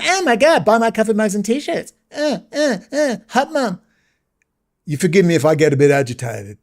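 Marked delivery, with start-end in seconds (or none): 0.0-3.8 high, breathy-sounding ditzy influencer parody, much higher; mock influencer. Mocking a commentator who sells mugs, ditzy high gush. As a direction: gush like a vapid online seller. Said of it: "telling everyone".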